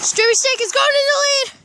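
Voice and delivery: normal talking voice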